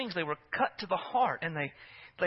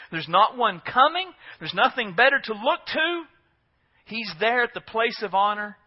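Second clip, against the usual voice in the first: louder, emphatic pulpit delivery; insistent, raised sermon voice; impassioned preaching; 6 semitones higher, 8 dB louder than usual. Real, not acted. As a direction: press the claim on the congregation. Preacher presses the point.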